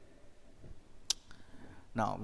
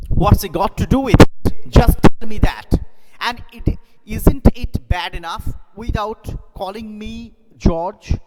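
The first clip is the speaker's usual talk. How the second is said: loud, high furious protest; loud angry shouting husband; angry George; 10 semitones higher, 12 dB louder than usual. Shown as a demonstration. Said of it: "George angrily".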